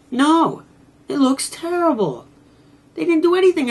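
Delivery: high, loud exasperated verdict. Real, not acted. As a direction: throw up your hands at how bad it is. Repeats verdict more exasperated.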